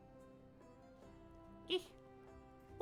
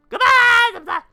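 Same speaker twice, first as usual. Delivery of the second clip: shouted high-pitched goodbye; loud high goodbye; 19 dB louder than usual. Real, not acted. Loud farewell to chat.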